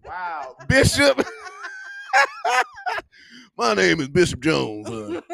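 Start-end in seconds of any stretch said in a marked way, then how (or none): 0.7-4.9 high, loud, laughing bishop intro; hammed-up bishop. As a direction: announce yourself like a bishop.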